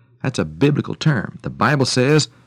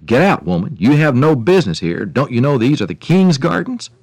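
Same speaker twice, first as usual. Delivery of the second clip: angry gardener, higher and louder; 4 semitones higher, 6 dB louder than usual. A narrator's reading. Gardener ordering a poor woman out of the king's gardens, angry. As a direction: order the intruder out indignantly. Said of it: "angrily told her".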